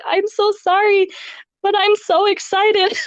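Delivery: bouncy, mock-giddy husband quote; imitating excited husband. Husband quoted hugging her.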